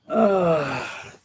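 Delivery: long falling groan; weary groan. Real, not acted. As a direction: groan it out, starting high and sliding down. Groaning about the heat.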